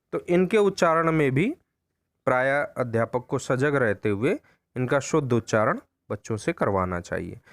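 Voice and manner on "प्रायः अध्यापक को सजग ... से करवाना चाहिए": normal talking voice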